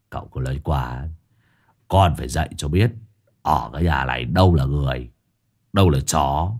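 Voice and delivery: low gruff voice, half amused; low chuckling reply. Acted. Disciple answering master, low and smug. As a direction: brush it off with a smirk. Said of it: "Hải Nghẹo cười cười".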